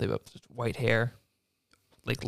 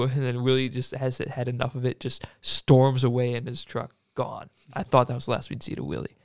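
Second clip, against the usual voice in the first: usual speaking voice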